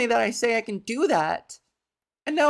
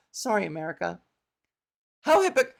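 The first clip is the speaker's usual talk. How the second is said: usual speaking voice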